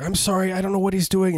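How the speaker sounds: high-pitched, helpless dad excuse; feigned innocent apology. Retold or imitated. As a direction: apologise innocently while covering for the shoplifting. Acts apologetic dad in store.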